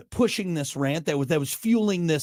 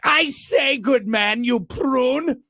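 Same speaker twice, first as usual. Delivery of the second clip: high, hammed-up British indignation; mock British accent; 7 semitones higher, 4 dB louder, much slower than usual. Host mocking a commenter's British insult, hammed-up British accent. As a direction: exaggerate a British gentleman's insult. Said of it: "Is that a British insult?".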